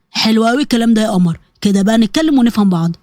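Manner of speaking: low, smug male voice; smug blackmailer. Acted. Blackmailer to his frightened victim, low and smug. Samir gloats over the frightened girl.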